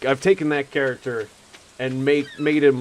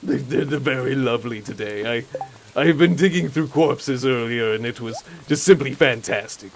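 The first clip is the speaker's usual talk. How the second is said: enthusiastic necromancer. Acted. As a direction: gush about corpses with delight. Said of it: "overly enthusiastic about zombies".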